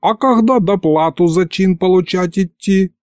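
high, put-on voice of protest; startled objection. A narrator's reading. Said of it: "вскинулся Михалыч". (возмущённо, высоким голосом)

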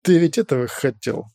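usual speaking voice

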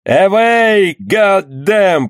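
shouting. Acted. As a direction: bellow it out again, dying. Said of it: "повторял он".

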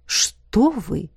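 mock-offended exclamation; feigned indignation. A narrator's reading. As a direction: protest with put-on outrage. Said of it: "с притворным возмущением воскликнул полковник". (с притворным возмущением)

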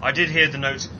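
usual speaking voice

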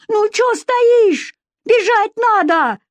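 very high, drawn-out shrieky call; urgent yelling. Acted. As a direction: urge them frantically to get moving. Villager urging Vika to run.

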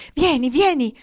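high, loud beckoning; urging her boy inside with joy; eager call. Acted. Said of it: "esclamò la madre". (richiamo alto e impaziente, piena di gioia)

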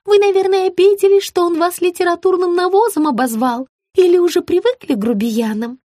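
high fussing concern; high sugary woman. A narrator's reading. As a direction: fuss over her. Margarita's voice.

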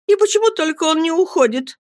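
put-on high petulant girl voice; girlish whine. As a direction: whine like a spoiled child.

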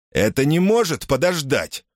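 grumpy insistence. Acted. Betts grumbling at Müller.

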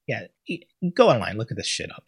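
ordinary talk

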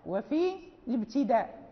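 normal talking voice

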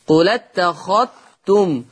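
قل اتخذتم run together as "qulattakhattum", ذ merged into ت; merged dhal. Shown as a demonstration. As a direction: link قل اتخذتم and double the t for the ذ. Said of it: "يدغم الذال في التاء". (قل اتخذتم بالنقل والإدغام)

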